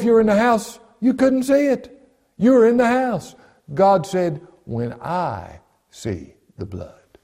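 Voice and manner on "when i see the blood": slow, low and softer; measured, weighty scripture quote; solemn divine pronouncement. Acted. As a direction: let each word of the quote land. God's words quoted slowly again.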